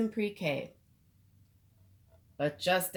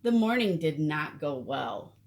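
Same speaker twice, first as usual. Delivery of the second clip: plain reading voice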